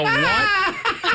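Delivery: squeaked way up high, laughing in disbelief; a mock shriek of surprise; shocked what. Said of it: "I go".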